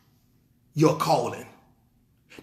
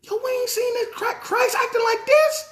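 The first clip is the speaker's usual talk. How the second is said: startled disciples; 12 semitones higher, 3 dB quieter than usual. Retold or imitated. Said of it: "They're like".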